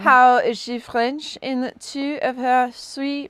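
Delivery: slow, hammed-up French accent. Shown as a demonstration. Joking with a friend about the audiobook, slow mock French accent. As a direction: lean into a thick French accent. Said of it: "that's too good of an accent".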